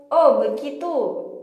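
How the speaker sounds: ordinary delivery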